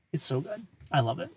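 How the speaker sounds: normal talking voice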